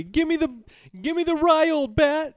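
shrill hammed-up Seinfeld yell; Jerry impression. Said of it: "see Jerry say".

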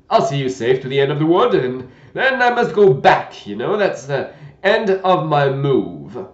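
put-on loud, gentle old knight; a kindly, bumbling old knight.

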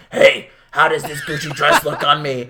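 hammed-up gruff trucker voice; mock trucker, a bit low; gruff truck driver. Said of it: "sound like a gruff truck driver".

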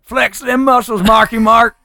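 high, slow mock-Texan holler; hammed-up Texan impression; Wes Anderson. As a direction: drawl it out in a put-on Texan accent. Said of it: "like, a Texan accent".

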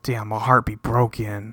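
low, soft, deflated muttering; mock dejection. Musician at the bar finds she didn't show, mock-dejected and low. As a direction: imitate a stood-up guy sighing to himself. Same retold letdown, continued.

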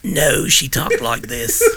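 slow, drawn-out old woman impression; lightly hammed-up old lady; mock old lady voice. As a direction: stretch it out like the old neighbor. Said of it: "Did she talk like this?".

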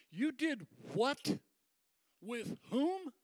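high, drawn-out disbelieving question; acted incredulity. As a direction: lift each question in mock shock. Believers confront Peter, retold.

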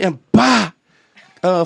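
usual speaking voice

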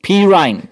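Pirine as PEE-rine, high then falling; commentator's pronunciation. Shown as a demonstration. Co-host confirming how the commentator said it, corrected pronunciation. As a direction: say Pirine with PEE first, rhyming with nine. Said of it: "Yes, the commentator was saying that".

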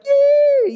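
very high, loud dolphin-like squeal of joy; gleeful imitated dolphin noise; Flipper squeal. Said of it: "He makes this little flipper noise".

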